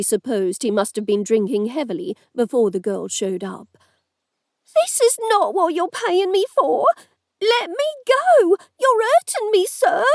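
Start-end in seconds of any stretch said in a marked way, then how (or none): 4.8-10.0 high-pitched, panicked woman's voice; panicked shout. A narrator's reading. Woman fights off the lord, panicked high shout. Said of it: "the woman shouted".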